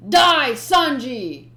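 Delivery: slow, loud killing yell; battle cry. Acted. Gin swinging at Sanji, slow loud battle yell. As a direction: bellow it as you strike.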